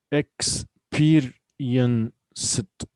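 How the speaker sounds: "Experienced" very slow, in separate beats; syllable by syllable. Shown as a demonstration. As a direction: break "Experienced" into ex, peer, ien, ced. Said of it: "we have four syllables here".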